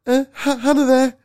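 put-on high, timid boy calling a girl's house; nervous kid on the phone. Acting out ringing a girlfriend's dad, high and nervous. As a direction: squeak it like a shy schoolboy. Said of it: "I'm gonna make a name up now".

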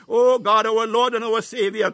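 ordinary delivery

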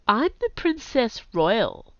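slow and deliberate; slowly. A narrator's reading. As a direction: draw each word out slowly. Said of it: "said Camilla slowly".

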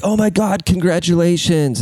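high-pitched mock congratulations; mock gushing. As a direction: imitate someone gushing with exaggerated excitement.

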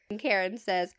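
normal talking voice

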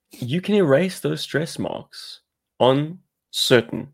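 slow, polished pitchman read; infomercial voice. Acted. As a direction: sell it like a late-night TV ad. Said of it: "You sound like an infomercial".

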